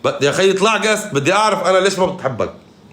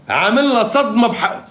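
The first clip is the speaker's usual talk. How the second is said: ordinary talk, nothing marked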